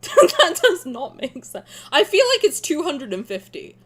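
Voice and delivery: ordinary delivery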